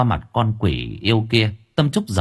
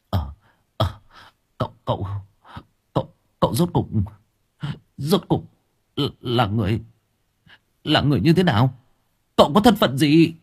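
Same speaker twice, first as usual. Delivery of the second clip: flustered stammer; 3 semitones higher, faster than usual. A narrator's reading. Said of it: "miệng lắp bắp bối rối".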